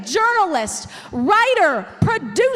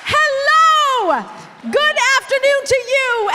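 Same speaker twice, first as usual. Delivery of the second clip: excited host shout; 10 semitones higher than usual. Real, not acted. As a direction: fire up the whole room. Host opening live event to crowd.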